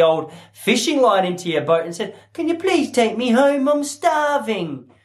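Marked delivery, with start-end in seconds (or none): 2.3-4.9 higher pleading voice, last words drawn out; pleading stranded girl. Retold or imitated. Girl asks the fisherman for a ride, pleading. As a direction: beg for a lift home. Voicing a stranded girl's plea.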